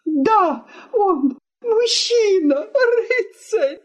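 very high, wailing sarcasm; tearful indignation. Acted. Wife mocks husband to guest, tearfully indignant.

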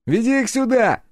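high, loud mocking call. A narrator's reading. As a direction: taunt them cheerfully. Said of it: "насмешливый голос напарника". (насмешливо)

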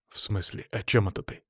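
soft, puzzled question; not understanding. A narrator's reading. Gorodetsky puzzled by the question about worms, quiet. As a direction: ask quietly, thrown off. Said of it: "не понимал Городецкий".

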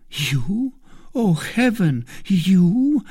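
high incredulous cry; disbelieving exclamation; startled cry. A narrator's reading. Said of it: "cried the queen".

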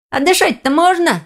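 high-pitched cheeky question; pupil from crowd. A narrator's reading. Pupil to the strict orc master, piping up. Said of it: "послышался голос из толпы".

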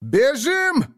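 high loud cry to run; shout. Acted. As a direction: yell at everyone to flee. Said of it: "прокричал Абу".

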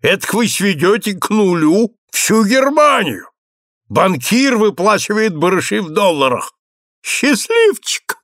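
high-pitched, delighted exclamations; carried away with admiration. A narrator's reading. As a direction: gush in awe, stretching the final exclamation high. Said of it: "супруг пришёл в восхищение". (с восторгом, на высоких нотах)

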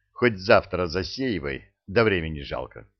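usual speaking voice

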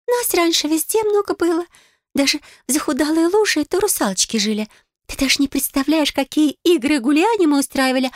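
high, wistful mermaid voice; a lonely mermaid remembering old days. Acted. Mermaid reminisces.

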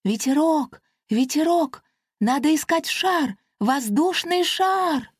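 high, excited fairy shout; calling pixie. Acted. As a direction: call out to a friend flying round. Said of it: "кричала Пушинка".

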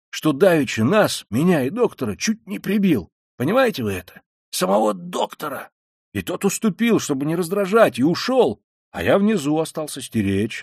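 high, agitated confiding; urgent half-whisper. A narrator's reading. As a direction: insist excitedly without shouting. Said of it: "продолжал он полушёпотом". (взволнованно, высоко, убеждая)

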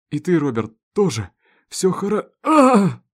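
loud, strained, ending in a high scream; reassurance breaking into scream. A narrator's reading. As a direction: reassure until pain cuts in. Reassurance cut off by pain. (успокаивая, сорваться на крик боли)

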